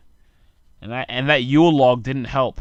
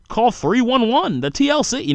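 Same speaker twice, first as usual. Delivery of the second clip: mocking official advice; 11 semitones higher, 4 dB louder than usual. Comic parroting the taxi commission's lost-property advice, high mocking voice.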